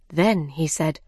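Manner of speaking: usual speaking voice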